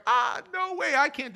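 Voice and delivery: sudden high yelp of disbelief; startled refusal. Retold or imitated. Moses balking at God's command, high startled yelp. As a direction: jump way up in pitch, balking at the task.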